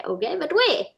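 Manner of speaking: a hint of a pushy navigation voice; GPS voice. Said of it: "he's gonna say".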